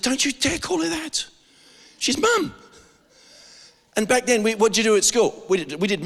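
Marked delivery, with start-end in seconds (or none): 0.0-2.5 sharp, raised-pitch telling-off, retold; a retold parent warning the son off; retold stern scolding. Pastor retelling how he warned his son, stern and high.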